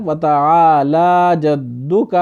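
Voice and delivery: slow وتعالی with a long la and جدک with a short dal; correct form. Shown as a demonstration. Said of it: "بلکہ کیا پڑھنا ہے؟".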